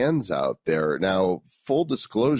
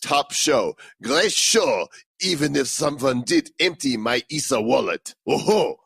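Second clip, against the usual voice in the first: higher-pitched hammed-up French accent; mock French accent; 5 semitones higher than usual. Host reading a French listener's review, mock French accent. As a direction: read it in a playful, high French accent. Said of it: "you have the best Francis accent".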